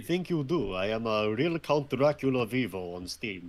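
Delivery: put-on vampire count voice, Dracula accent. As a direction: speak as a stagey Dracula.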